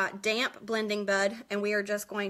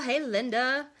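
normal talking voice

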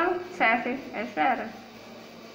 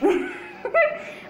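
nervous high laugh. Real, not acted. Nervous laugh while playing.

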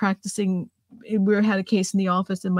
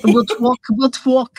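imitating a Brooklyn New York accent, a touch louder; 2 semitones higher, 4 dB louder, slower than usual. Host echoing her guest with a mock Brooklyn accent. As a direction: echo the line in a playful Brooklyn twang. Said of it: "you sound like my".

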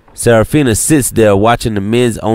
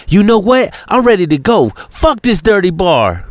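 frustrated; 10 semitones higher than usual. Acted. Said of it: "Serafina is frustrated".

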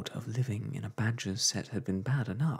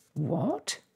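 a sharp, higher rising what; 8 semitones higher, 3 dB louder than usual. A narrator's reading. Wife startled by the letter, sharp surprise. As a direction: snap the question out. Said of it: "exclaimed Homily sharply".

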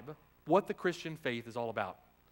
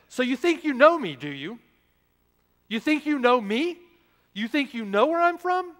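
forceful, mocking "you think you know me", higher and louder; sarcastic rebuke; 8 semitones higher, 9 dB louder than usual. Shown as a demonstration. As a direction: rebuke them with biting irony. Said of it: "you could say it sort of like this".

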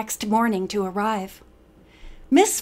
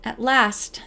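ordinary delivery, nothing marked

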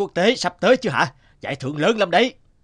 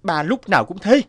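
loud, higher resentful complaint; bitter complaint; 4 semitones higher, 6 dB louder than usual. Acted. Son complaining to mother about father, loud and resentful.

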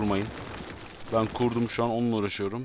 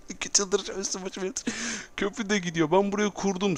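exasperated; 9 semitones higher than usual. Real, not acted. Bridge collapsing, complaining.

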